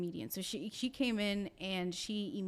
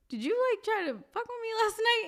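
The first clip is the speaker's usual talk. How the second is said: high, louder accusing question; 14 semitones higher, 6 dB louder than usual. Retold or imitated. Sister confronting her brother the next morning, high and accusing. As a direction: pitch it up in disbelief. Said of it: "and I'm like".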